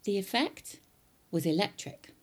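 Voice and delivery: usual speaking voice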